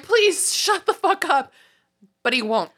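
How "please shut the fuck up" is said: high, slow exasperated elf voice; fed-up house elf. Retold or imitated. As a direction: beg him to stop, way up high and slow. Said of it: "Winky's like".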